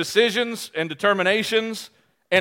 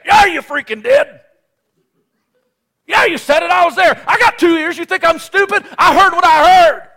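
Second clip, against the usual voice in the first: much higher and louder, heated comeback; angry retort; 9 semitones higher, 8 dB louder than usual. Retold or imitated. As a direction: fire back like you're ready to fight. Retold heated argument, hitting back.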